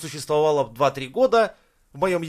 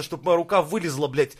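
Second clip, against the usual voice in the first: ordinary talk, nothing marked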